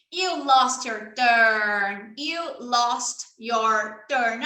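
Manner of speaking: You lost your turn said very slowly; slow teacher demo. Shown as a demonstration. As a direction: spell it out slowly for a child. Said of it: "demo for a child".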